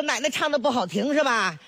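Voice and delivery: usual speaking voice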